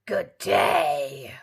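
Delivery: loud growling wolf greeting; growled. A narrator's reading. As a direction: growl it like a big hungry wolf. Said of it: "growled the wolf".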